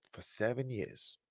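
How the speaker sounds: "For seven years" dropped soft and low; quiet repeat. Real, not acted. Father repeating the years apart to himself, soft and low. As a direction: echo "For seven years" under the breath.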